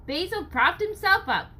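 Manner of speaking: usual speaking voice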